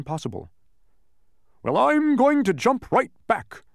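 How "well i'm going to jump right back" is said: high, loud, drawn-out declaration; bold announcement. A narrator's reading. Humbug about to leap off, bold announcement. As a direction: proclaim it with blustering determination. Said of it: "announced the humbug".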